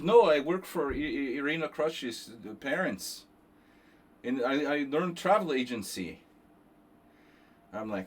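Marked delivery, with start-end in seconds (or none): 0.0-6.2 mimicking a thick Ukrainian accent; mock Ukrainian-accented reply; imitating Novikov. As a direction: quote him in a heavy Ukrainian accent, slowly.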